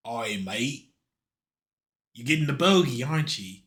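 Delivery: hammed-up Australian woman, half English with a twang; a quick, lightly done Australian-English twang; mock Australian accent. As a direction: do a jokey Aussie accent that slides into English. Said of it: "I just went English with it, but it's, like, English with a bit of twang".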